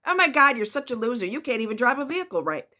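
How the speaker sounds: mock the driver with scorn; contemptuous mockery. Toxic partner sneering at a bad driver, loud and scornful. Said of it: "They're not gonna say".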